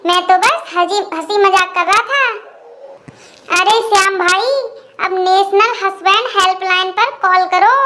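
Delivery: ordinary delivery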